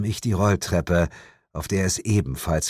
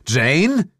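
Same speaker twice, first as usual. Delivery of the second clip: higher, louder astonished call; surprised call; 9 semitones higher, 5 dB louder than usual. Acted. The narrator recognizes his ex and calls her name in astonishment. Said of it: "rief ich verblüfft".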